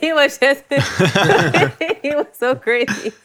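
slow, laughing wonder. Real, not acted. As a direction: laugh it out, amazed. Laughing at kids' critique.

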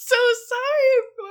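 high, slow, cry-laughing sorry; apologizing to listeners, breaking up laughing; laughing apology. Real, not acted. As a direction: apologize while still cracking up.

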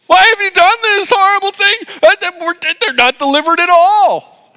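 hammed-up bawling like a little baby; Moses. Moses runs back crying to God, babyish wail. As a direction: wail it very high and loud, mock crying. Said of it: "He cries to God".

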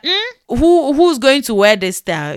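disdainful high hum, then a blunt question; mock blunt disbelief; sharp-tongued tailor. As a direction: imitate a tailor scornfully sizing up a style. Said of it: "Their mouth is sharp".

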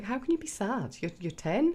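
exasperated parent, half-mocking her own impatience; frustrated disbelief. Scene: mother to ten-year-old daughter, exasperated disbelief. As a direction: replay your own frustrated reaction, lightly. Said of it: "I get frustrated with that, 'cause I'm like".

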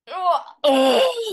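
high loud cry of release; emotional release cry. Real, not acted. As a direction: let out a sudden crying oh. Big release during tapping.

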